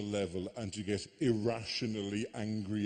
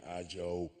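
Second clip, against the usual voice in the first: mumbled Irish caller; 6 semitones lower, 5 dB quieter, much slower than usual. Retold or imitated. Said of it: "it was all very".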